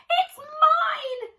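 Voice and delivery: high, loud squirrel shout. Acted. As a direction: yell it, staking your claim. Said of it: "shouted Cyril".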